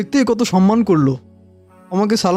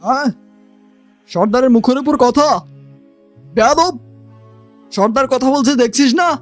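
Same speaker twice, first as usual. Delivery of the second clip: higher-pitched, indignant scolding; outraged telling-off, pitch raised; angry rebuke; 4 semitones higher than usual. Acted. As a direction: put the insolent underling in his place. Leader's man rebukes insolent underling.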